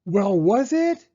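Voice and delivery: high, loud, slow demand; eager demand. A narrator's reading. Rocket rushes to the tree wanting the ending, loud high. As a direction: press for the answer impatiently.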